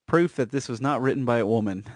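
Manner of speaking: usual speaking voice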